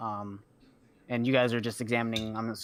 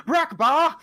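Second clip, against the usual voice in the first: loud, high call across the room; 8 dB louder than usual. Acted. Clerk summoning the half orc cook, a loud high call. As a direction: yell for him from the front desk.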